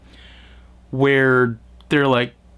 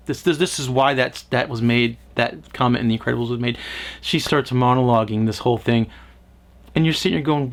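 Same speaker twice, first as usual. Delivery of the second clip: ordinary delivery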